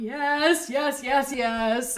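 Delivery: loud, high yes, yes, yes through a contraction; hammed-up cry of yes mid-contraction; mock yes in labor. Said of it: "I'm going".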